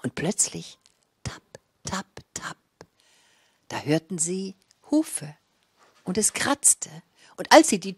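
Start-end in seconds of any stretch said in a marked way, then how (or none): 1.2-2.6 breathy whispered tapp tapp; hushed tapping sounds; whispered knocking. A narrator's reading. Knocking sound in fairy tale.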